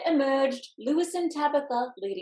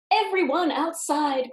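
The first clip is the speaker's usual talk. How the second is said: firefighters crying out; 5 semitones higher, 5 dB louder than usual. A narrator's reading. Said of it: "cried the firefighters".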